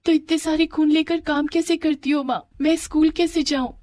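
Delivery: put-on high, plaintive child's voice; acted anxious little girl; worried daughter. Worried daughter asks mother.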